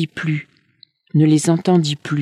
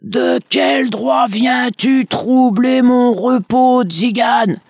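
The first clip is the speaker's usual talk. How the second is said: higher, slow, louder monster voice; terrifying dragon; 8 semitones higher, 4 dB louder, slower than usual. Acted. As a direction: growl it out as a menacing beast. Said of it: "gronda-t-il d'une voix aussi terrifiante".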